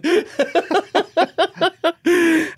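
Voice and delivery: long high-pitched laugh; sustained giggling laughter. Real, not acted. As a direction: laugh out at a nerdy joke. Laughing at the techie joke.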